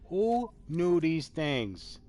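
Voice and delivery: slow, dragged rhetorical ask; slow drawn-out question. Acted. Vlogger slowly marvelling at odd news facts. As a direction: stretch each word out.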